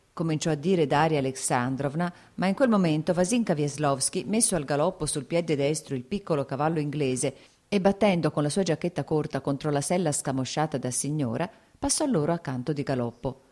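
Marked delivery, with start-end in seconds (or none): none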